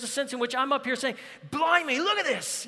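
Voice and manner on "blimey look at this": high-pitched, hammed-up Aussie exclamation; terrible Australian accent. Preacher playing crocodile guy over God's word, bad Australian accent. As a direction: badly imitate an excited Australian wildlife host. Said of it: "That's a terrible accent".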